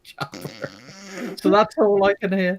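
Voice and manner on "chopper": put-on much softer Cornish-accented echo; quiet Cornish burr, trailing the joke; Cornish Schwarzenegger. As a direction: murmur it in mock Cornish. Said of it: "this kind of Cornish accent".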